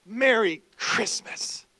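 slow, deliberate Merry Christmas; emphatic slow correction; pointed merry christmas. Pretend. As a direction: draw it out to make a point. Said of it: "You're like".

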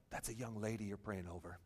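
very low, hushed aside; imitating someone quietly tipping you off; discreet correction. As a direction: break the awkward news softly. Retold discreet correction from others.

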